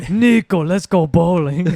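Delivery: loud, slow Eastern European cousin. Acted. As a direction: call out like an eager cousin. Impersonating bowling-obsessed cousin.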